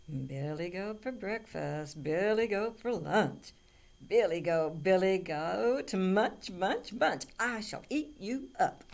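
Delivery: loud troll chant, slow and building; hungry troll. A narrator's reading. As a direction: relish each munch, building to a shout. Troll threatens to eat biggest goat.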